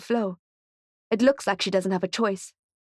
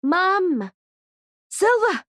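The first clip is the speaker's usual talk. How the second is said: much higher, louder shout; 11 semitones higher, 4 dB louder, much slower than usual. A narrator's reading. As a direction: shout across the crowd to find her. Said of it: "she shouts".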